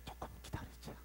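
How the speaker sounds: whispered, holding back anger; restrained whisper. Acted. Preacher voicing God deciding to wait, hushed whisper. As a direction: breathe it as a held-back promise.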